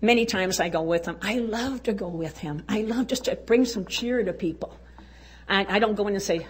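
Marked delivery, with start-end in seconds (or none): none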